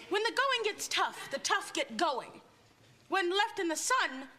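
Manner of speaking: usual speaking voice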